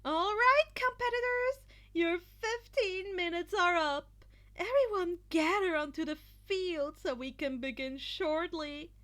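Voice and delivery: high, loud cheerful announcer voice; Midnight cheering. A narrator's reading. Midnight calling everyone onto the field, high and loud. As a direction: call the contestants in brightly. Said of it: "Midnight cheered".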